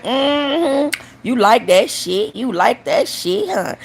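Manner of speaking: drawn-out, slow sassy genie. Acted. Genie greets runner next day, sassy drawl. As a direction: stretch it out, knowing and teasing. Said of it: "She was like".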